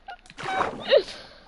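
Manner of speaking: high squealing burst of laughter; shrieking laugh. Real, not acted.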